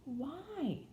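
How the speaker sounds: high, cool little question; coldly. A narrator's reading. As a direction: ask it high-pitched, with little warmth. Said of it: "Mary coldly".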